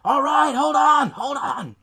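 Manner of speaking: high, drawn-out holler to the door; mock harried shout, easing off; exasperated calling out. Harried man answers pounding kids.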